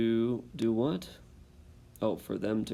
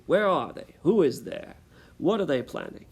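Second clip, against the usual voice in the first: louder, higher demanding questions; stern demand; 6 semitones higher, 7 dB louder than usual. Acted. Soldier with a pistol interrogating Dicey, harsh demand. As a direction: press her hard at gunpoint.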